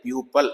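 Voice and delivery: प्यूपल with a schwa, pyoo-puhl; correct pronunciation. Shown as a demonstration. Teacher corrects students, saying प्यूपल low. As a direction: say प्यूपल low, last syllable reduced. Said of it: "इसको प्यूपिल बोलते हैं बहुत से बच्चे".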